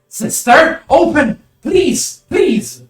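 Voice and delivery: high, loud pleading shout through a door; desperate yell. Acted. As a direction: scream for her to open up. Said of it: "as he yells".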